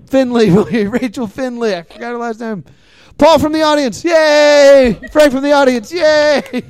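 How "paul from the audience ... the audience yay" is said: raised, loud billing with cheers; mock announcer cheer. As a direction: announce them big, then cheer. Host mock-bills audience members.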